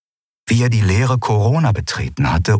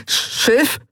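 breathless croak. A narrator's reading. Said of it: "brachte er hervor".

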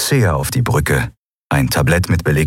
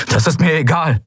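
raised pitch, brushing off the objection; defiant outburst; 10 semitones higher than usual. Acted. Kai storms out on the admiral, defiant. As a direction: cut him off, higher-voiced and defiant.

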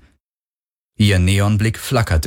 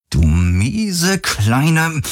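drawn-out, rising anger; seething insult; 7 semitones higher, much slower than usual. Acted. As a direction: stretch the insult slowly as temper rises. He starts to insult her. (gedehnt und wütend, höher)

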